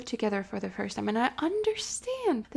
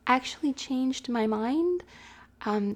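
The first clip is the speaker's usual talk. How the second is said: ordinary delivery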